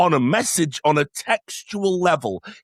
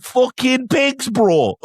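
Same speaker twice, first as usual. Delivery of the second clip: drawn-out, much higher retold gasp of disgust; shocked outburst; 10 semitones higher, 4 dB louder, much slower than usual. Cinema neighbour blurting at the Cersei scene, mock shocked and slow. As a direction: imitate a lad stunned at the screen, slow and high. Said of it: "he audibly was shocked".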